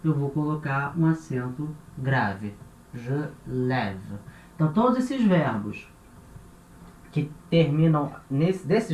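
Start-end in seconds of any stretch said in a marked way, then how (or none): 2.9-4.2 "lève" with an open "eh" and silent final E; E aberto. Shown as a demonstration. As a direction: demonstrate "je lève" with a clear open È. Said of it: "pra indicar esse som de E". ("lève" com E aberto e final mudo)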